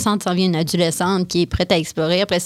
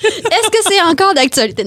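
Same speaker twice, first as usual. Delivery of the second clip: excited; 12 semitones higher, 5 dB louder than usual. Real, not acted. Mock question, laughing.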